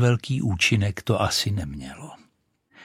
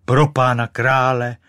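startled exclamation; 3 semitones higher, 6 dB louder than usual. Acted. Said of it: "zvolala husa".

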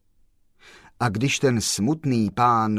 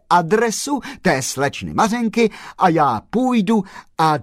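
higher, louder animated character voice; lively voiced dialogue; 7 semitones higher, 6 dB louder than usual. Postman urges, the gentleman stops him, animated and louder. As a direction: play the characters with eager energy.

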